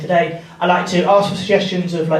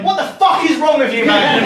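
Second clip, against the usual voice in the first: higher, laughing mock outrage; mock exasperated; 8 semitones higher than usual. Comic yelling at a guest on the telly, laughing. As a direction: pretend to yell at the telly through a laugh.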